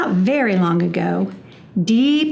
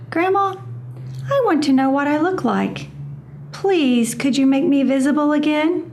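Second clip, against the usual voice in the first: put-on higher, pleading child voice; little possum voice; 6 semitones higher than usual. A narrator's reading. Hush begging Grandma to make her visible, high and pleading. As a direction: ask sweetly and a bit faster, like a small child. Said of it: "Hush said".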